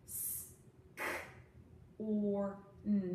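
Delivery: S, k, or, n each said as a separate sound with pauses; sounds one by one. Shown as a demonstration. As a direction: unblend scorn sound by sound. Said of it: "watch me unblend it".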